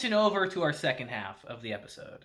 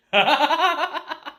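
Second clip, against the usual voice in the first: loud, high burst of laughter; real cackling laugh; big cackle; 10 semitones higher, 6 dB louder than usual. Real, not acted. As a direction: crack up at your own joke. Cracking up at own joke.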